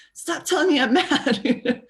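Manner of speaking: high, slow, laughing mock protest; hammed-up child snapping back; mock angry kid. Child fed up with being told their feelings, mock angry. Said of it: "'cause you're like".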